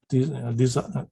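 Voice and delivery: normal talking voice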